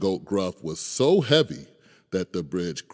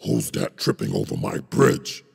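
much lower troll roar; 11 semitones lower than usual. Acted. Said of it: "roared the troll".

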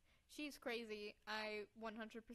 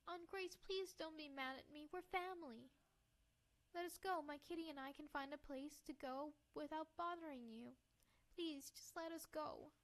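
put-on higher-pitched, earnest girl voice; pleading girl; 4 semitones higher than usual. Girl begs her aunt to let them go, pleading. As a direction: beg gently in a young heroine's voice.

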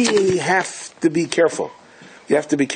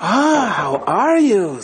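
high, very slow, drawn-out greeting; a put-on grand host voice, stretched out; fawning welcome; 8 semitones higher, much slower than usual. Innkeeper welcoming the nobleman in a slow high voice.

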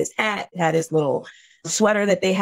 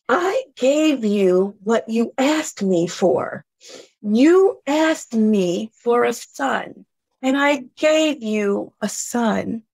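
slow, measured divine voice; God's voice answering; 3 semitones higher, much slower than usual. Retold or imitated. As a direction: lay out each word slowly like a calm answer from above. Voice of God answering.

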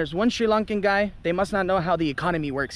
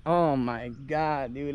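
softer and slow, shocked under the breath; stunned disbelief; 5 semitones lower, 5 dB quieter, much slower than usual. Retold or imitated. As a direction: let it out slowly, in disbelief. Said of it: "And I'm like".

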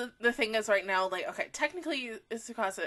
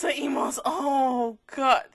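normal talking voice

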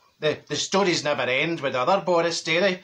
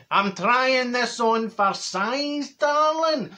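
slow, sing-song camp voice rising high on darling; hammed-up luvvie neighbour, drawn out; 6 semitones higher, much slower than usual. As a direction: neighbour showing off his foil blanket, camp and slow.

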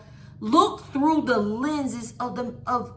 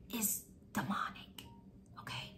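whispering; 4 semitones lower, 17 dB quieter than usual. Real, not acted. Hushed aside about secrets.

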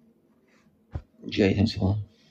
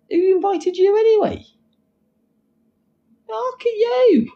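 very high put-on sneering voice; mock troll comeback; 3 dB louder, slower than usual. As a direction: fire a comeback in a squeaky voice.

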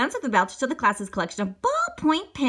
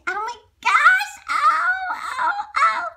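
high, loud squeals of excitement; excited shriek; 5 dB louder, much slower than usual. A narrator's reading. Kids at the opening door, shrieking with excitement. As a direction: shriek like a thrilled child.

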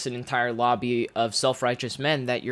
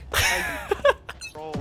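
high falsetto laugh. Real, not acted. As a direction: burst into a high giggle. Laughs at insult.

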